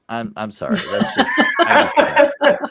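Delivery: helpless laugh breaking into the words, pitch shooting up; laughing too hard to finish. Real, not acted. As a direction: crack up while apologising. Cracking up over the name.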